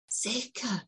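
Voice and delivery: soft imitation of the boy. Boy repeats the word softly.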